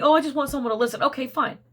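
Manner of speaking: ordinary talk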